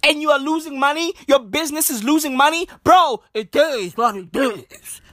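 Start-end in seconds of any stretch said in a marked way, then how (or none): 3.3-5.0 lightly voiced, mumbling minister shrugging it off; mock a politician's resigned delivery; imitating the minister. Comedian mimicking the minister brushing off power cuts, lightly put-on voice. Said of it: "He talks like that".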